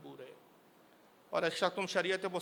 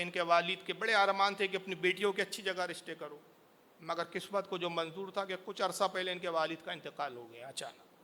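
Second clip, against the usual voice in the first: ordinary talk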